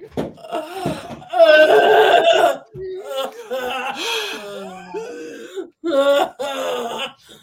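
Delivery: loud hammed-up gasping and wailing; drawn-out mock crying fit; mock gasping sobs. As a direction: imitate her freaking out with big gasps. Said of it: "Robin's there going".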